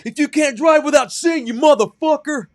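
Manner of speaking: high, very loud abusive rant; imitated furious screaming. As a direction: scream it as an enraged stranger. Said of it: "this weird guy who screamed at me".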